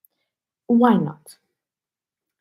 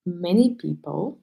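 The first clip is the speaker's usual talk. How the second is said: ordinary talk, nothing marked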